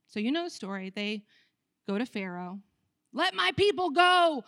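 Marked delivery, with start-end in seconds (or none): 3.1-4.4 proclaiming. Acted. Moses proclaims to Pharaoh.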